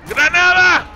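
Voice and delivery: loud, high drawn-out yell; shouted grenade warning. Pretend. Soldier warns squad of grenade, shouted warning. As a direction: yell it out with each throw.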